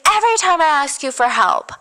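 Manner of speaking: high, contained retold yell; mock screaming. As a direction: imitate a screamed complaint without full volume. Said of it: "she's screaming".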